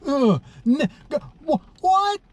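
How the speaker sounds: very high strained mock outburst; hard self-talk. Talking hard to himself when nobody's watching, high sputtering. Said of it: "hard, dude. Hard. I'm like".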